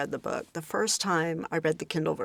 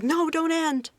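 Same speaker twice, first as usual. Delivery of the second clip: mock pleading protest; 12 semitones higher, 4 dB louder, slower than usual. Retelling her reaction to book ending.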